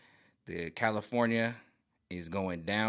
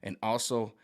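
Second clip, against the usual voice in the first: ordinary delivery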